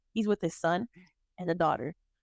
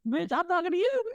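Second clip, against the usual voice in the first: sassy comeback; 9 semitones higher than usual. Retold or imitated. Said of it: "I was like".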